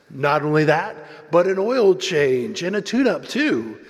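loud promo announcer upping the offer. Retold or imitated. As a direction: pitch the bonus like an advert voice-over. Voicing the video announcer's offer.

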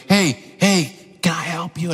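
lightly imitated child, muffled under the noise; drowned-out child. Son calls to dad over the leaf blower, half drowned out. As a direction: mimic a kid half-lost under machine noise.

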